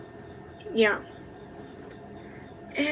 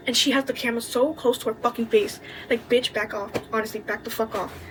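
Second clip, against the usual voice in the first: ordinary talk, nothing marked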